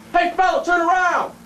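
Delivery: high, loud cop's shout; yelled command. Acted. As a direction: yell the order up the stairs. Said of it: "I yelled up to him".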